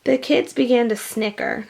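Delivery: plain reading voice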